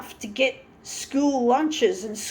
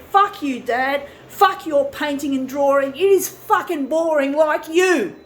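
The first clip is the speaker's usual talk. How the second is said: retorting to dad; 3 semitones higher, 5 dB louder than usual. Retold or imitated. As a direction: shout it back at dad, defiant. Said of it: "but I say".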